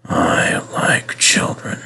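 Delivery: slow, dreamy whisper; hushed, wistful old engine. A narrator's reading. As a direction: whisper it to yourself, lingering on each word. Repeat, lost in memories.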